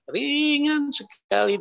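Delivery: high, drawn-out, softening; lifted relief. Retold or imitated. Man tells how light he felt on waking, lifted relief. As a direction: float the first word up high and hold it.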